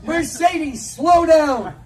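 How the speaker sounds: mock parent yell; yelling at child. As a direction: shout after a running kid. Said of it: "yelling things like".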